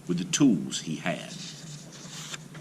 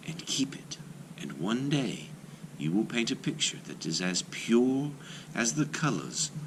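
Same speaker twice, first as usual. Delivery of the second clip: put-on young maiden; 5 dB quieter than usual. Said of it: "she told him".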